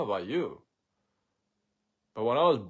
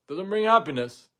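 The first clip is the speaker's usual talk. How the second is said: high, loud sarcastic echo of the saying; mocking loud repeat; 8 semitones higher, 9 dB louder than usual. Shown as a demonstration. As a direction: throw the cliché back, louder and higher.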